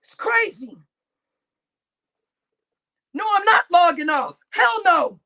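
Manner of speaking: ordinary delivery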